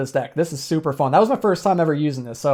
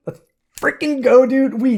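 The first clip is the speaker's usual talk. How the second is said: excited cheer; 6 semitones higher than usual. Real, not acted. Gamer cheering his comeback win to viewers, excited cheer. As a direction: let it burst out as the win lands. Winning the boss fight.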